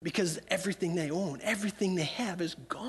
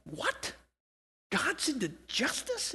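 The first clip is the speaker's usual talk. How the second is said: softer, slower astonished question; softer and slower, a seeker astonished that God cares about justice; surprised disbelief; 4 semitones higher, 5 dB quieter, slower than usual. Retold or imitated. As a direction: hush it in wonder, amazed at the news. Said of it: "they're going".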